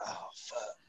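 breathless whispered curse; whispered dread. Retold or imitated. Two soldiers realizing the dash is burning, whispered curse. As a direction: hush it as the smoke hits.

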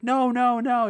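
very high, loud frantic protest; hammed-up panicked guy; mock frantic no. Podcast guest panicking at the host, hammed-up high shout. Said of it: "He's like".

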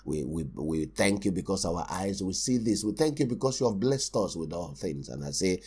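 soft, hushed, low prayer voice; mock soft thankful prayer; gentle quiet prayer. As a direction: pray tenderly and quietly. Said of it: "if I go to a place and say".

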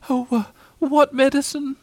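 high, hesitant little-animal voice; falter, pitched high, dreading the answer; nervous squeaked question; alarmed. A narrator's reading. Piglet alarmed by medicine.

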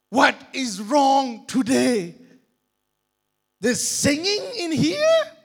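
high, loud outraged complaint; angry criminal shouting at the noise. Acted. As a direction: yell it like a woken-up prisoner.

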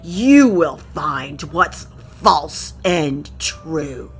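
slow, solemn crocodile reciting a riddle. Acted. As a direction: intone the riddle slowly like a grand reptile king.

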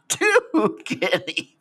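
high, very slow Cockney street cry; Cockney chimney sweep. Pretend. Sweep offering his services for pay, high drawn-out Cockney. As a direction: stretch it out high, like a Cockney hawker. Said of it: "Cockney eighteen eighties chimney sweep".

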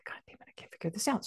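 quick whispered mock yell; exasperated aside. Reader retelling private yelling at the spirit, whispered exasperation. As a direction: curse under your breath in a fast whisper. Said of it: "I'm, like, yelling at this poor man".

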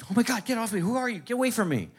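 high, panicked mother pushing him off; frightened mom. Retold or imitated. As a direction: shriek it like she doesn't know him. Confused mom frightened of son.

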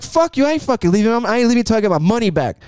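high, rapid mock tirade; imitating an outraged, hurried protest; retold angry refusal. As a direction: act out a customer standing his ground. Acted quarrel, man refuses to leave.

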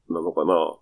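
normal talking voice

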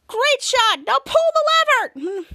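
high, loud cheering that turns urgent; excited shout. Retold or imitated. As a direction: cheer the shot, then yell the order. Shadow cheers then urges.

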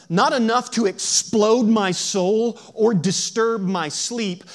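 slow, emphatic sermon reading; dramatic recitation. Acted. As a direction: recite it loud with drawn-out key words.

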